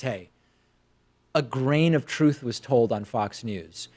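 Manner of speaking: ordinary talk, nothing marked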